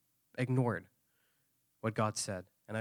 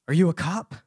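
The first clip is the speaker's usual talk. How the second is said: alarmed question; 4 semitones higher, 7 dB louder than usual. Retold or imitated. Suspicious friend confronts speaker.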